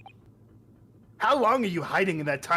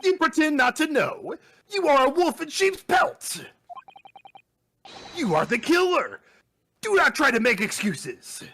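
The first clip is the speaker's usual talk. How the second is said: higher, stern accusing delivery; mock accuser; 9 semitones higher than usual. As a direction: hurl the accusation theatrically. Voicing accusing game character.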